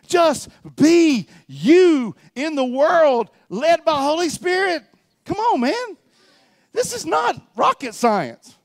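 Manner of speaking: high, loud, drawn-out exhortation; full-force preaching. Real, not acted. Preacher pleading with the church to be Spirit-led, full-force preaching. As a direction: hammer it out slowly to the congregation.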